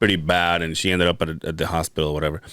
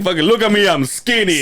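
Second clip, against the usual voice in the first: cocky dad; 8 semitones higher, 4 dB louder than usual. Retold or imitated. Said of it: "he's all going like".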